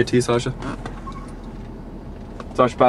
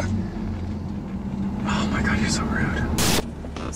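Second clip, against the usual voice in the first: whispered aside; 11 dB quieter, much slower than usual. Acted. Muttered aside to the dog.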